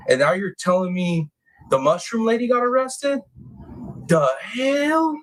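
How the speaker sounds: climbing high into the hell; rising disbelief; incredulous. Real, not acted. Streamer recapping the news to chat, incredulous rise.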